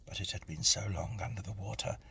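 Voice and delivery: ordinary delivery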